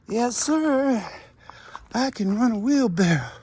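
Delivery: put-on beaming kid declaring his one skill; imitating Rodney. Boy proudly answering the gardener, high and loud. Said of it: "That's how he talked".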